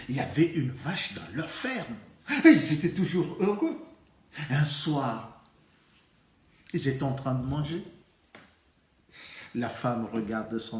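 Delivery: lively folk-tale teller, punching each new event; storytelling for children. A narrator's reading. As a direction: tell it to little ones with big swings and pauses.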